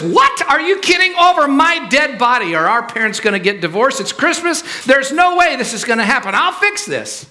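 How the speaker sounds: very high, indignant outburst; hammed-up high-pitched outrage; outraged sister. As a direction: explode in disbelief at the news. Acted sister outraged at the news.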